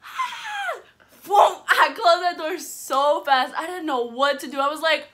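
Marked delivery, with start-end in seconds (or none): 0.0-0.8 very high startled squeal; re-enacted scream. Seeing Rosa on opening the door, startled high scream.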